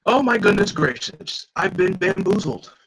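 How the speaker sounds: high, breathy fake astonishment; mock shock. Villain mocking the heroes with fake surprise, high and airy. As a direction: ham up being fooled.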